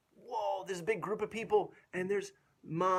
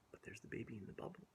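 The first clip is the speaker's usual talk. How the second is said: fast hushed whisper; 6 semitones lower, 18 dB quieter, much faster than usual. Real, not acted. Whispered aside to child.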